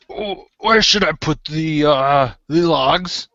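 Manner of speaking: slow-witted helper character. Acted. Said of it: "and he says".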